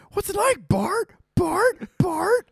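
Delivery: very high cartoon kid voice; squeaky kid repeating the name; Milhouse impression. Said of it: "where he's like".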